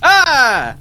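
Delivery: high loud Italian-style yell; a sudden dramatic cry; mock Italian cry. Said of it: "In an Italian accent".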